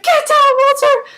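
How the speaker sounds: high, loud imitation of a frantic warning; mock urgent yell. Peter warns Walter during the shooting, high mock yell. Acted urgent command under fire.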